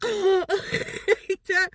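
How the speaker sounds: high, strangled laughing gasp; mock gasp. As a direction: imitate choking on the mask air. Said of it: "I go".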